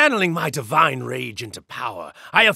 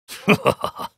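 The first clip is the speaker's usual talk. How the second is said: mocking laugh; 4 semitones higher than usual. Laugh after attack.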